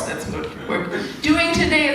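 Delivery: normal talking voice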